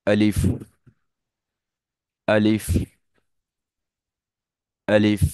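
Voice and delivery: আলিফ short and crisp, lips apart, final ফ just breath; correct Arabic alif. Shown as a demonstration. Teacher demonstrating আলিফ for students to repeat, crisp and short. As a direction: say আলিফ quickly, no drawn vowel, air flowing on ফ. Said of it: "আমি বলার পর একটু সবাই বলুন".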